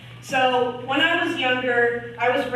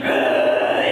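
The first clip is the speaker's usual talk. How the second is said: loud harsh animal roar; big hammed-up beast noise; imitated gorilla roar; 8 dB louder than usual.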